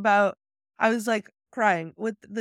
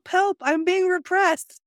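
high, drawn-out cry for help; hammed-up yell of an oppressed man; mock yell; 9 semitones higher, 4 dB louder, slower than usual. Quoting the man rolling in filth, mock yell. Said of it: "he was yelling".

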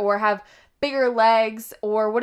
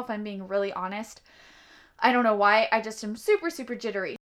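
normal talking voice

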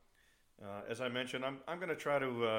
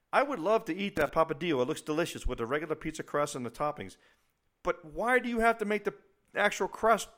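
high, loud exasperated rant; high-pitched outraged complaint; 11 semitones higher, 11 dB louder than usual. Retold or imitated. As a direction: rant it out, voice climbing in disbelief. Ranting quote of own reaction.

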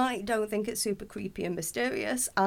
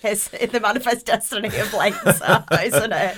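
laughing; 7 dB louder than usual. Real, not acted.

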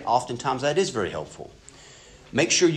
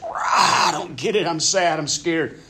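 higher, strained inner cry; a mock inner wail of confusion and fear; inner turmoil; 7 semitones higher than usual. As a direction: let the hidden frustration burst out. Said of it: "Where internally you're just".

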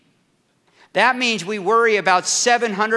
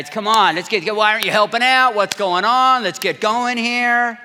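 louder, impatient push to hurry; bossy nagging; 5 semitones higher, 6 dB louder than usual. Acted. A Martha type hurrying everyone to help, louder and impatient. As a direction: hustle the slackers along.

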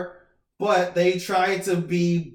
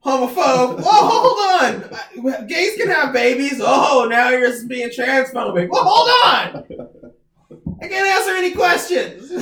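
high laughing shouts of fake outrage; laughing mock-indignant yelling at reporters; mock outraged shouts; 7 semitones higher, 7 dB louder, slower than usual. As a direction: ham up the offended deflecting. Mocking press secretary's deflections.

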